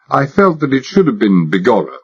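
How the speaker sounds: ordinary delivery, nothing marked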